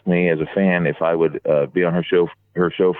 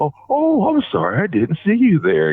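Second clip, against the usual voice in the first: higher, teasing fake-surprised apology; mock apology; 12 semitones higher than usual. Slaughter teasing Kevin Nash by the elevator, mock apology. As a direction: act out a playful "oh, sorry".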